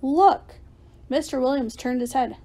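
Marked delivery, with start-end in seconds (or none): 0.0-0.6 high, loud call; shouted alert. A narrator's reading. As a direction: call out to point something out. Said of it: "I shouted".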